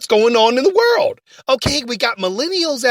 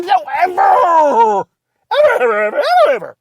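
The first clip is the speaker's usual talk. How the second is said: exasperated yelling; 7 semitones higher, 4 dB louder than usual. Acted. Host ranting at Ray.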